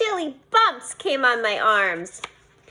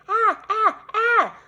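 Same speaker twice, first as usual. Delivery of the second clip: high mock scream; childish pretend scare yell; playful scream; 9 semitones higher, 3 dB louder, much slower than usual. A narrator's reading. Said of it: "I screamed".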